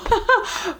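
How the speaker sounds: a short high laugh breaking through; real nervous laugh while holding back feelings; emotional laugh. Real, not acted. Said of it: "I try not to get emotional".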